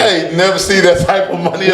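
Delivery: real disbelieving laughter; laughing in disbelief. Real, not acted. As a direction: laugh as you say it, still amazed. Overwhelmed by the contract money.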